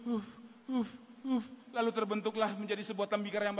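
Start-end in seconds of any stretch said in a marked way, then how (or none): 0.1-1.4 short puffs of air; imitated blowing. Shown as a demonstration. Preacher shows clay being merely blown on, imitated puffs. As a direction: blow gently in separate puffs. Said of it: "Diembus-embus".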